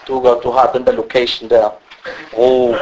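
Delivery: ordinary talk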